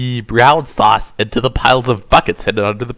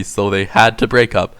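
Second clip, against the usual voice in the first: ordinary delivery, nothing marked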